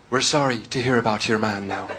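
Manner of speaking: soft, low, lightly acted sympathy; mock condolences. Irish pubgoers to an Australian, gently acted condolence. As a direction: offer hushed sympathy to a stranger.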